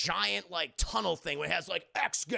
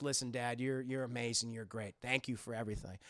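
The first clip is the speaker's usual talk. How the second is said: low, soft, subdued; quietly heartfelt, lightly acted; sincere thanks; 7 semitones lower, 6 dB quieter than usual. Max apologizing to his dad, softly sincere.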